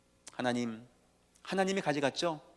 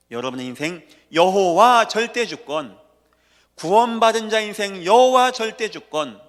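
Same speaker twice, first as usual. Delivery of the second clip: preaching at full force; 6 semitones higher, 11 dB louder than usual. Acted. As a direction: pound each word out to the congregation. Chanting sovereignty refrain.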